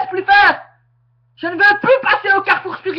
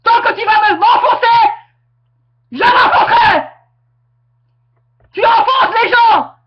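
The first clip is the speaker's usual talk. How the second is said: real angry yell, raised and pushing hard; aggressive shouting; 3 semitones higher than usual. Real, not acted. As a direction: shout her down, furious. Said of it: "je serai agressif toute l'année".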